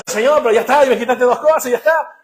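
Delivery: high, loud, pleading complaint; acted protest. Believer complains to God about losing things, loud protest.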